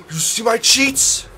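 surprised gasp. Real, not acted. Spots cheats shown on screen.

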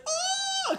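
very high held squeal; high-pitched mock yelp. Imitating the grabbed wrestler, high mock scream. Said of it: "the guy's just like".

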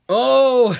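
loud, high-pitched "oh"; exclaiming. Acted. Acted reaction to pie invitation.

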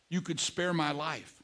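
soft, hushed request for mercy; queen quietly begging the king; quiet plea. Retold or imitated. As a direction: say it low, as if afraid to ask. Esther pleading with the king.

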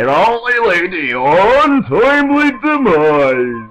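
high, loud, drawn-out hammed-up doom; silly put-on voice. Gamer scolds himself over his death, silly put-on voice. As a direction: swoop slowly and loudly through a stupid voice. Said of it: "I need to stop talking like that. That voice is stupid".

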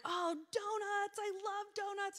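high, slow, gushing delight; gushing over donuts. Retold or imitated. Speaker retelling her donut moment to church, high gushing delight. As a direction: swoon over the donuts like a kid. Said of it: "And I'm like".

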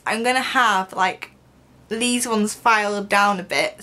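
talking with lip pulled down; speaking around a fingertip. Real, not acted. Seen 4: fingertip pressed on lower lip while pointing at teeth.

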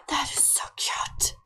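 gasp, then whisper it, overcome; cute-overload whisper. Real, not acted. Player overcome by her little boy running around, awed whisper.

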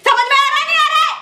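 high, loud angry yell. Acted. Girl yelling at the couple to make them understand, loud and high. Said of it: "क्या चिल्ला रही है इतना".

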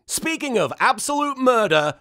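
normal talking voice

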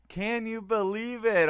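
loud amazed repeat; excited disbelief. Real, not acted. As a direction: shout it out in amazement. Repeating stunned result.

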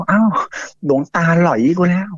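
mock dismay: the monk fooled us; shocked realization. Villagers find the jar empty, dismayed. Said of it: "อารมณ์ประมาณเนี้ย".

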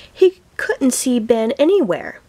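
ordinary delivery, nothing marked

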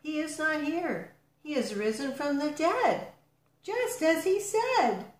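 high, ringing proclamation; storyteller's high angel voice; angel announcing. A narrator's reading. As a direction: proclaim it as an angel, stretching the final words. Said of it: "one of the angels asked".

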